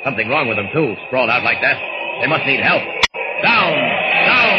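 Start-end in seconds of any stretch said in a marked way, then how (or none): 3.4-4.6 loud high yell of warning; sudden sharp cry to get down; warning shout. Acted. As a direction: bark out a desperate warning. Limey shouts warning at him.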